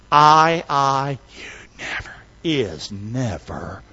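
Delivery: slow it right down, word by word; hushed slow lesson. Shown as a demonstration. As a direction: drop from firm to a near whisper. Slows chant down for lesson.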